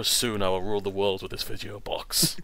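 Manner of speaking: low, quiet evil villain; menacing whisper like the devil. Retold or imitated. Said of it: "like the devil going".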